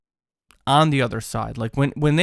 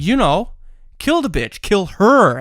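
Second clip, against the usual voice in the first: much higher and louder, urgent and fierce; fierce realization; 7 semitones higher, 5 dB louder, slower than usual. Retold or imitated. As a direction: spit it out as sudden grim certainty. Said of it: "he said to his cellmate".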